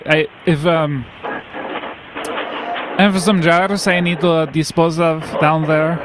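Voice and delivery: normal talking voice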